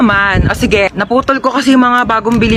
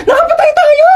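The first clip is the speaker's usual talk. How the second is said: shrieked much higher and louder, with a cry; panicked scream; 4 dB louder than usual. Acted. Driver screams at her companion that they killed someone, panicked shriek. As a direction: scream it in terror.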